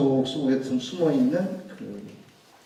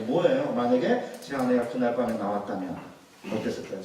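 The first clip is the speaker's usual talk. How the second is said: ordinary talk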